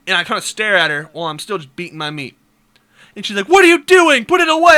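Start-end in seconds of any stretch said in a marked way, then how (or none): 3.5-4.8 high, loud mock scolding; appalled yelling. Girlfriend catching her boyfriend, high appalled yelling. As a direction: yell as the horrified girlfriend.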